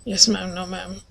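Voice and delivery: soft, meek yes ma'am, no ma'am. Retold or imitated. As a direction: mumble it out softly like a scolded kid. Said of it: "I was like".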